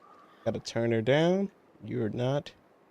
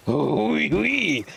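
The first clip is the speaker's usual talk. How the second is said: mock French; 8 semitones higher, much slower than usual. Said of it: "Does it?".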